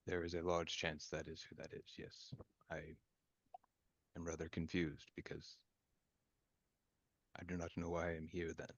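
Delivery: low, very soft measured voice; confused formal character. Acted. Confused character admits to not knowing why they are here, quiet and formal. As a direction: speak softly and low, admitting puzzlement.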